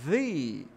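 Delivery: THE with a prolonged ee sound; THE as "thee". Shown as a demonstration. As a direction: draw THE out into "theee". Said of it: "com som de i, parece".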